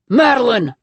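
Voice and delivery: loud call; husband calling while breaking in. Acted. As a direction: call her name as he bursts in. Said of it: "he calls".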